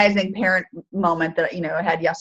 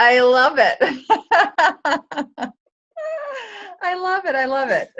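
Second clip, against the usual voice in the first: high, delighted words through laughter; real laughter; 8 semitones higher than usual. Real, not acted. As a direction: crack up while saying it. Listener cracks up at the joke.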